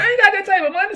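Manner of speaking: put-on falsetto old man, laughing through it; high old-timer whine with a laugh.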